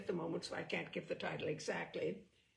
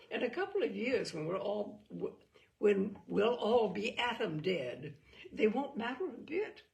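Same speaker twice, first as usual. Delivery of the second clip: lightly; 3 semitones higher, 7 dB louder than usual. Acted. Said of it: "says quite lightly".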